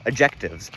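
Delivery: "adjectives" with popping ejective stops; ejective sound. Shown as a demonstration. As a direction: pop the consonants in "adjectives". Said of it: "for example".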